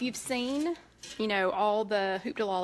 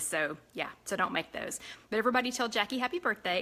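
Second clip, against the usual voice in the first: ordinary talk, nothing marked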